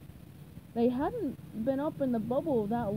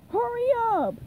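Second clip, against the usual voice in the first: high, loud, impatient call; raised impatient holler; shout; 10 semitones higher, 6 dB louder than usual. A narrator's reading. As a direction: yell to hurry the crowd. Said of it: "he shouted".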